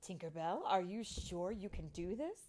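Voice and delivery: kindly fairy queen asking; happier voice. A narrator's reading. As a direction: ask it lightly, lilting up at the end. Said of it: "Let's use a happier voice".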